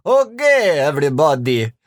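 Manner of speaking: ordinary talk, nothing marked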